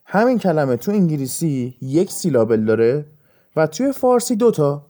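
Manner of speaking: ordinary delivery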